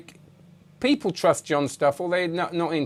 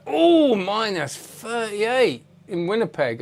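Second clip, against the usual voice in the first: surprised; 7 semitones higher, much slower than usual. Real, not acted. Reading viewer's freezing temperature.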